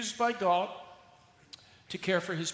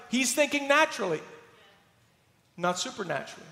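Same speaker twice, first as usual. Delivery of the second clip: ordinary delivery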